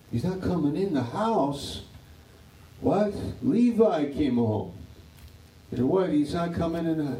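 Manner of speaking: father stunned that his son won't come home; surprised father. Acted. Father's shocked disbelief.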